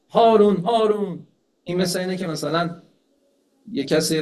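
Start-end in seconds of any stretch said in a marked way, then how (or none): 0.1-1.3 slow, loud, higher call of a name across a crowd. Retold or imitated. A man in the crowd calling the caliph by his first name, loud. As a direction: shout the name over the crowd, drawn out.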